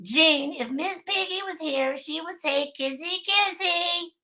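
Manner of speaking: high, soft sleepy put-on voice; sleepover voice. Shown as a demonstration. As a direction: read it out in that high sleepover voice, drawing out the end. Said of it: "You say this in that voice".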